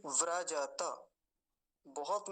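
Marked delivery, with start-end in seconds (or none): 0.1-0.8 व्रजत as vra-ja-ta, every vowel full; open final ta. Shown as a demonstration. Teacher presenting the next word, open final ta. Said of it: "अगला शब्द देखिए".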